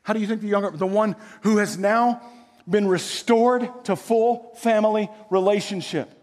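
normal talking voice

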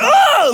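high, loud mock scream; freak-out scream. Student late for his exam, freaking out. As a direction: scream out in acted panic. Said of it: "and I freak out".

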